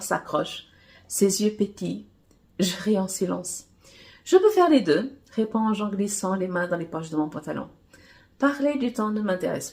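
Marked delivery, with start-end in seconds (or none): 4.2-5.2 bold reply. A narrator's reading.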